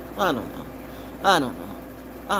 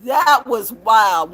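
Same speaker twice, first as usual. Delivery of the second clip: drawn-out, slow amazed exclamation; stunned; 5 semitones higher, 5 dB louder, much slower than usual. Real, not acted. Still shaken, telling viewers about her dream. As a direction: stretch out how shocking the dream was. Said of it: "I still haven't calmed down".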